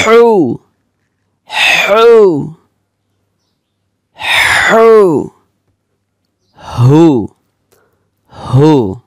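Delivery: হৌ called out high and loud; repeated high, loud হৌ drill. Shown as a demonstration. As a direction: practise হৌ with force. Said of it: "বেশি বেশি মশক করতে হবে".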